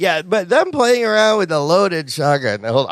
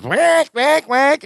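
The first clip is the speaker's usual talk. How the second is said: high squawking duck-call imitation; 8 semitones higher, much slower than usual. Imitating Bucky blowing the call, high squawks. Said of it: "pulls out the duck call and goes".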